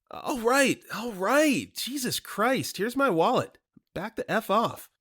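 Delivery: hammed-up frightened friend; frantic surrender. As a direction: hand over the wallet in a squeal. Ted hands over his wallet.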